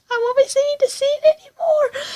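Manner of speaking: high, breaking voice through real tears; sobbing the words out; crying. Real, not acted. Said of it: "tearing up".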